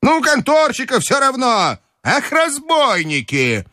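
high granny outrage, ending drawn out; indignant grandmother. A narrator's reading. Grandmother railing at the money changers, indignant. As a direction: complain in a high old-lady cry. Grandmother raging at the exchange office.

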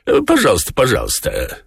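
talking cat. A narrator's reading. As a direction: start high as the cat, then drop. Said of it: "отозвался кот". (кот: с высокого голоса вниз)

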